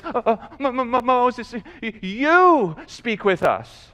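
stammering, halting plea; mock fearful stammer. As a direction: stumble over the first sounds as if terrified. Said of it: "They're afraid of God".

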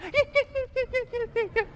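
very high, soft rapid yips; fleeing hyena yelps. Retold or imitated. Preacher imitates hyenas running from the lion, high yipping. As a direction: squeal like little hyenas running off. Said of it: "That's how they run".

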